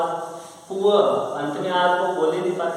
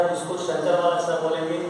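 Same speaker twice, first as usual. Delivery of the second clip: normal talking voice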